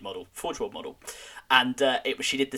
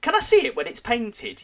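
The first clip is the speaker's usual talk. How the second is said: imitated mum; 12 semitones higher, 6 dB louder than usual. Imitating mum asking to see model.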